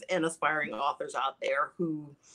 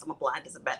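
ordinary talk

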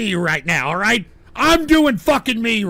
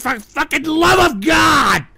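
higher, slow, drawn-out shout; exasperated outburst; 4 semitones higher than usual. Real, not acted. Host pleads for quiet.

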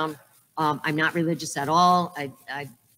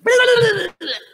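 a high, loud, sudden laugh; loud high cackle of a laugh; loud burst of laughter; 8 dB louder than usual. Real, not acted. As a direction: crack up out loud at the thought. Imagining doing something wacky.

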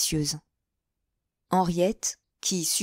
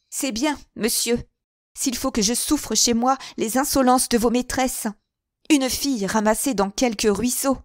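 higher, louder cutting insult; last insult; 6 semitones higher, 4 dB louder than usual. A narrator's reading. Henriette insulting Mouret's shopgirl, bitter and cutting. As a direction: lash him with a final jab. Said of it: "le fouetta d'une dernière injure".

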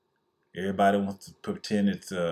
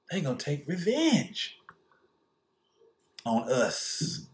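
much higher condescending ruler voice; mock scheming elite; 7 semitones higher than usual. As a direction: talk down to poor whites, wheedling. Voicing scheming elite addressing poor whites.

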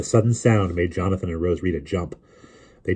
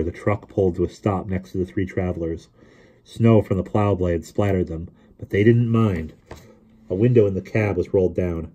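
ordinary delivery, nothing marked

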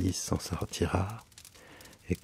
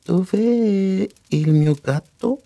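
very slow, high, loud foreigner-directed question; trying out Italian; 11 semitones higher, 7 dB louder, much slower than usual. Acted. Alice tries Italian on the foreign mouse, slowly and loudly enunciating. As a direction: sound out each Italian word high and slowly. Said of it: "Je vais essayer de lui parler italien".

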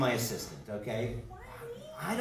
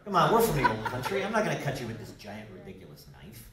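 ordinary talk

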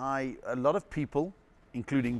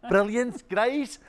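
fake English accent; 9 semitones higher, 6 dB louder, much slower than usual. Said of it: "talking like that".

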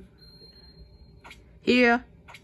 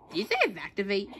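normal talking voice

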